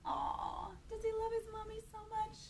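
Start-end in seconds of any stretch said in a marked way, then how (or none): none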